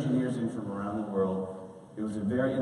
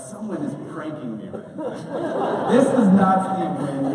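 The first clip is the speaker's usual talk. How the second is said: laughing disbelief; 5 semitones higher, 6 dB louder than usual. Retold or imitated. Disbelief after the famous call.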